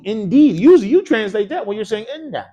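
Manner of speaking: ordinary delivery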